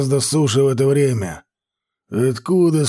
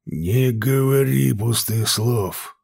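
slow drawn-out warning; drawled; 3 dB quieter, slower than usual. A narrator's reading. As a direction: stretch the words out, coolly menacing. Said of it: "протянул Ширам".

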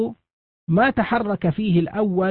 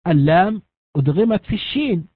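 ordinary talk

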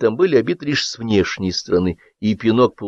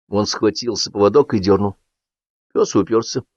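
ordinary delivery, nothing marked

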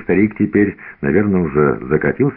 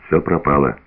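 usual speaking voice

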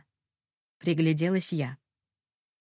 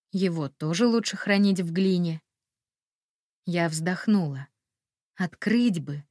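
ordinary delivery

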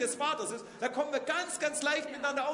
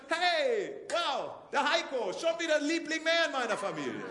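delighted Father; 3 semitones higher, 4 dB louder than usual. Acted. God voiced delighting in children.

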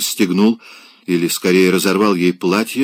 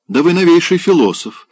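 bright, teasing lift on the quip; a merry, lightly mocking compliment; cheerful remark; 10 semitones higher, 4 dB louder than usual. A narrator's reading. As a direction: toss it off merrily, amused. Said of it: "весело заметил Райский".

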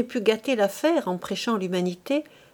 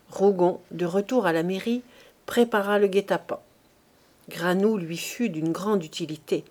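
ordinary delivery, nothing marked

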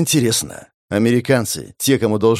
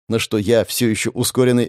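ordinary delivery, nothing marked

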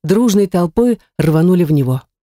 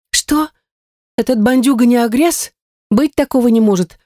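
higher-pitched, incredulous outburst; disbelieving exclamation; 7 semitones higher than usual. A narrator's reading. Lira refusing to believe the skull about the thug, disbelieving exclamation. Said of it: "воскликнула Лира".

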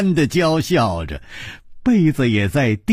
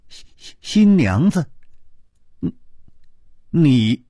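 stammering, dumbfounded questions; dazed. A narrator's reading. Hero learns of a mix-up about the bride, dazed. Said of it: "他痴痴地说".

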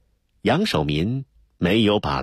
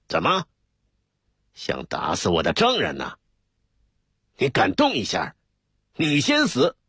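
threatening. A narrator's reading. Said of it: "反击道".

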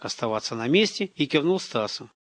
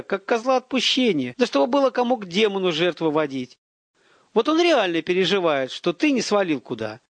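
contemptuous tirade; 8 semitones higher than usual. A narrator's reading.

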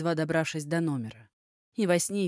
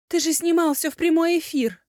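high, loud panicked scream; 12 semitones higher, 7 dB louder than usual. Acted. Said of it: "заорала Элли".

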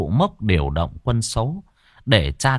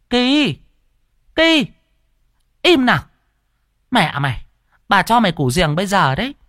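high, loud scolding shout; grandma shouting at the barking dog to hush; yelling at the dog; 11 semitones higher, 4 dB louder than usual. Acted. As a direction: shout the dog down like an old granny.

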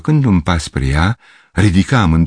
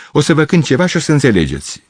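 higher, fast eager promise; eager exclamation; 8 semitones higher, faster than usual. A narrator's reading. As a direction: rush to offer to play. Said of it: "exclamă".

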